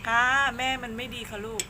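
loud, cooing pet-talk; cutesy small voice. Real, not acted. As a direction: blame yourself sweetly to soothe a cat. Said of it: "ทำเสียงเล็กเสียงน้อย".

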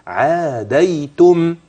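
عاديتم slow, final meem clearly closed; الوقف على عاديتم بميم واضحة; pause on meem. Shown as a demonstration. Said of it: "لما وقفت".

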